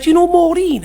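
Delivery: high, sing-song mock Welsh; Welsh accent. Retelling a local's question, high Welsh accent. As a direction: imitate a Welsh local, lilting high and loud. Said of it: "impressed with that Welsh accent".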